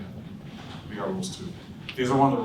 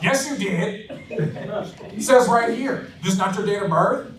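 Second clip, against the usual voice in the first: higher, pushy insistence; arguing with customer; 9 semitones higher, 4 dB louder, slower than usual. Retold or imitated. Young salesman arguing with a prospect, higher and pushy. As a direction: argue the point back hard.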